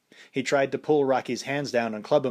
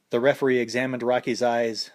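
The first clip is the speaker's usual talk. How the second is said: ordinary delivery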